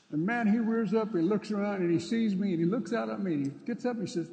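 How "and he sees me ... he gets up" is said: usual speaking voice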